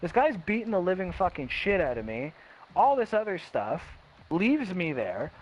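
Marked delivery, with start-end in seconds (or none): none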